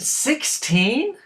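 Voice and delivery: high, incredulous question; stunned father's question; shocked disbelief. Acted. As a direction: pitch shoots up in disbelief. Father shocked by son's secret.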